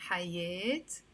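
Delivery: "حياة" ending in eh plus t, hayet; long eh then t at the end of "حياة"; Lebanese T ending. Shown as a demonstration. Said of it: "In Lebanese Arabic, we say".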